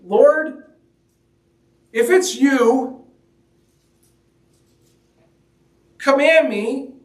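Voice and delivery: high, slow, pleading Peter; voicing Peter. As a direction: stretch out Peter's uncertain plea. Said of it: "Peter says".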